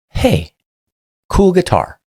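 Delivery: high, light friendly voice; friendly greeting. Retold or imitated. Officer building rapport with a homeless man, high friendly voice. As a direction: sound easygoing and harmless. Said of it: "So I say".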